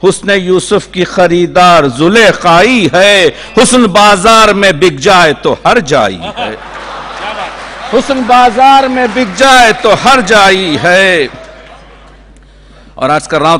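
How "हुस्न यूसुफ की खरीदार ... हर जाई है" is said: high, impassioned declamation; Urdu nazm recitation. Acted. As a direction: project each rhyme to the crowd. Recites nazm in full flow.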